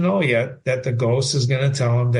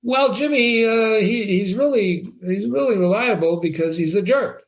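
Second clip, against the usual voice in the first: mock student answer; 7 semitones higher than usual. Teacher role-plays student answer.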